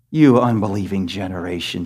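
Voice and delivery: low, soft, weary rebuke; Jesus sighing at a faithless crowd; annoyed Jesus. Retold or imitated. Preacher voicing Jesus rebuking the unbelieving crowd, low and weary. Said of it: "can you sense the annoyance of Jesus".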